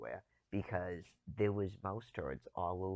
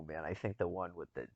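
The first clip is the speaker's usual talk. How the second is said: ordinary talk, nothing marked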